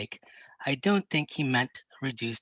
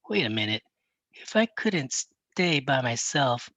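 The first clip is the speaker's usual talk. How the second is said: usual speaking voice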